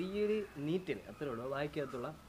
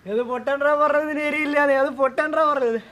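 pained shouting; 11 semitones higher, 13 dB louder than usual. Real, not acted. Burning mouth, shouting complaint.

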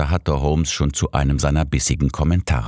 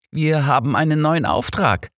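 joyful; 12 semitones higher than usual. A narrator's reading. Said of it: "teilte ihr Holmes freudig mit".

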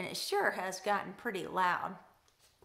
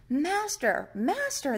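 louder storybook cry for help; raised, pleading call; 4 semitones higher, 6 dB louder, slower than usual. A narrator's reading. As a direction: call out urgently as the frightened disciples. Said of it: "they cried".

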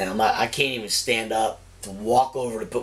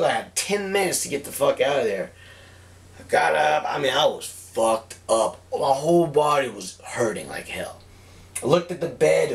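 ordinary talk

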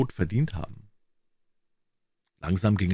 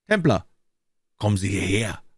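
sharp command. A narrator's reading. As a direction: order him over. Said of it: "kommandierte er scharf".

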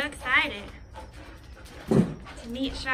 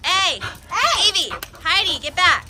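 sharp loud yells, higher; shouting at the dogs; 4 semitones higher, 10 dB louder than usual. Real, not acted. To the dogs rushing the new puppy, loud commanding shouts. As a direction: yell to stop the dogs.

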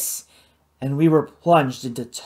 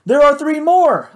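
loud, high alarmed yell; 10 semitones higher, 7 dB louder than usual. A narrator's reading. Matt yells warning about hatched creatures, loud alarm. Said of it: "I yelled".

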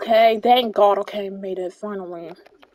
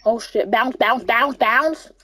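ordinary delivery

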